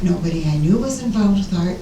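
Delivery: ordinary delivery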